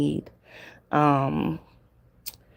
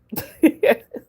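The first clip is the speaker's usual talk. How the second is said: high giggle; 11 semitones higher than usual. Real, not acted. Tickled by caller's voice.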